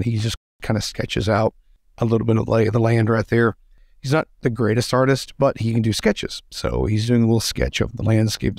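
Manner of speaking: ordinary talk, nothing marked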